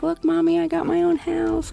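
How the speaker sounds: high, bright-eyed little squeal of joy; imitating excited daughter. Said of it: "Like, she was very excited".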